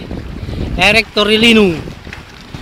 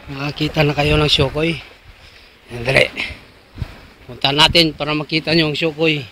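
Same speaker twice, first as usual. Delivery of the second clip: hushed whisper; 7 semitones lower, 8 dB quieter than usual. Pretend. Hiding, whispering about Shukoy.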